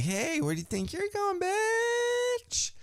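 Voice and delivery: high, taunting mocking voice; hammed-up high sneer; mock thug taunt. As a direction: sneer it like a tough heel. Riot Squad threatening, quoted.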